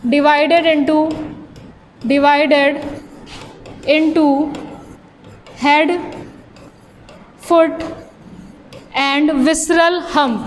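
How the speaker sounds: halting pace for note-takers; slow dictation. Real, not acted. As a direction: dictate word by word with long pauses. Dictating notes to students. (रुक-रुक कर धीरे लिखवाते हुए)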